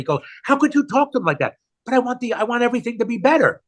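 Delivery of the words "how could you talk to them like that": high, quick, indignant protest; mock scolding yell. Father imitates his kids telling him off, mock indignant yell. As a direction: scold him in a raised pitch, only imitating. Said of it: "My kids would have yelled at me".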